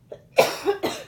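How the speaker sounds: sharp high dry cough; quick cough to clear the throat. Real, not acted. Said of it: "Excuse me".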